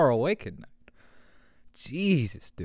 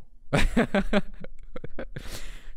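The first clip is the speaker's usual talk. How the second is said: laugh; 8 semitones higher than usual. Real, not acted. Laughing at cat voice.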